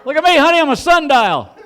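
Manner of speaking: very high, loud, laughing; gleeful bragging to wife. Pretend. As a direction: crow to honey with delight.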